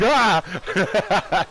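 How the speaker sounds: sudden high yelp; mock scream. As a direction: imitate a startled scream. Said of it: "shattered Civ screams, like".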